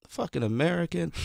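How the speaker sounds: imitated quiet grumble; said to herself. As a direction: mimic a woman cursing under her breath. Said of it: "she said to herself".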